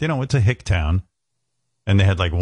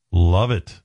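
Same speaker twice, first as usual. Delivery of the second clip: usual speaking voice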